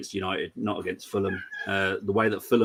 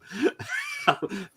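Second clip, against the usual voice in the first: high squealing laugh. Real, not acted. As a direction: burst of high-pitched laughter. Cracks up about upsetting Cole.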